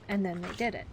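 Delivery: ordinary delivery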